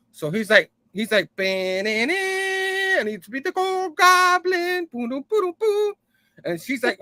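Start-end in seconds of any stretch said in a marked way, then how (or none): 1.4-4.9 very high, slow, stretched villain voice; squeaky, drawn-out cartoon goblin. Reading the Goblin's lines. 4.9-5.9 mock booms. Goblin noise effects.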